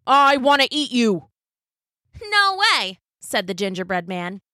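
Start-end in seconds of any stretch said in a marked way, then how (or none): 0.1-1.3 cop voice. Acted. Cop chasing. 2.2-3.0 high cheeky cookie voice; gingerbread man voice. Acted.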